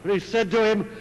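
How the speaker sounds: preacher bursting into the king's outburst; explosive narration. A narrator's reading.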